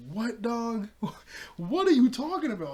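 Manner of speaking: loud, high-pitched incredulous outburst; acted-out baffled protest; exasperated disbelief. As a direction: call him out, half laughing. Retells own exasperated reaction.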